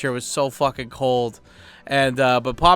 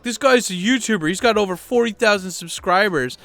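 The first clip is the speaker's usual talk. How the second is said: eager bragging; 6 semitones higher than usual. Retold or imitated. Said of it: "this guy's like".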